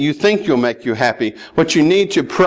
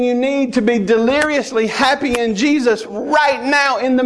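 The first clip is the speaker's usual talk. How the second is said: impassioned plea; 7 semitones higher than usual. Acted. Pleads at raised pitch.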